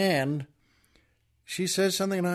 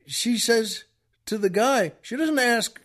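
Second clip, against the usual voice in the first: plain reading voice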